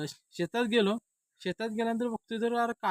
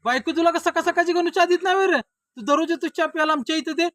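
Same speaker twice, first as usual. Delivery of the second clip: high-pitched, loud ribbing; loud needling; 10 semitones higher, 9 dB louder than usual. Acted. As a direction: grill the friend at the top of your voice. Host needles friend over morning tea. (चढ्या आवाजात टोमणे)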